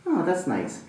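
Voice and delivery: soft, relieved sigh of pleasure; police officer enjoying a ray of sunlight, softly; soft contentment. Retold or imitated. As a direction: murmur it like sun on your face. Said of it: "And he's like".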